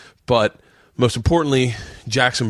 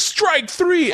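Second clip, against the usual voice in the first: high, bright umpire call, pitched way up; kid calling a strike. Retold or imitated. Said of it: "He goes".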